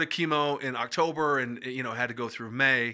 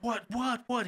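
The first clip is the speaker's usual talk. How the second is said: retold alarm; 9 semitones higher than usual. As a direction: imitate a son rushing in worried. Son rushing down, alarmed, retold.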